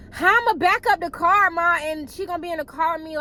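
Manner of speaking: put-on a scared teen asking mom how to back out; nervous pleading. Scared teen asks mom how to back up. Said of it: "Like, I'm scared".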